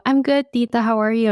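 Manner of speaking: retold greeting. Greeting aunt in retold scene.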